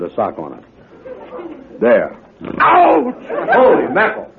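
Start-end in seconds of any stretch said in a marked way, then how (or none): 2.6-3.1 sharp high ouch; yelp of pain. Acted. Andy pinching his own foot, a sharp high yelp. 3.5-4.3 high startled exclaim. Acted. Andy hears the other head, startled and high.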